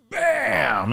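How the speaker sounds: drawn-out, high "bam" falling away; stretched grenade-blast noise; imitated explosion.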